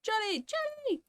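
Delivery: way up high, calling the name twice; high sing-song call. Real, not acted. Greeting Johnny in chat with a high sing-song call. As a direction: call out like spotting a friend.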